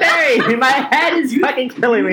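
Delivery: high, laughing mock plea; retold pain plea. Telling Mary at night that his head is killing him. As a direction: whine about the headache half-laughing. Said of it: "I'm like".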